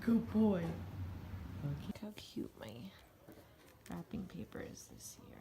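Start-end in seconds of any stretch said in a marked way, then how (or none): 1.6-5.4 whisper. Real, not acted. Showing wrapping paper quietly.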